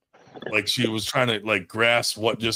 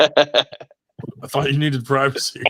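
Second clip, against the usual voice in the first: mock teasing dad; 5 semitones higher, slower than usual. Dad teasing his daughter.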